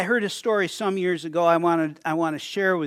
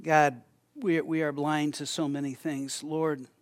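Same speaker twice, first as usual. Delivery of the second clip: closing prayer; 2 semitones lower, 5 dB quieter than usual. Real, not acted. Pastor leading the congregation in closing prayer, hushed. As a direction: lead the room gently into prayer.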